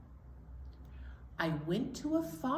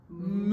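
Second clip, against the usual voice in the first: cow moo; 4 dB louder than usual. Acted. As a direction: moo it long and full. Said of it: "The cow said".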